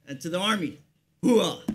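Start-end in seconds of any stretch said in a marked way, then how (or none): 1.2-1.6 loud gung-ho soldier shout; battle cry. Shown as a demonstration. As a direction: shout the Army cry with force. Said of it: "There's our battle cry".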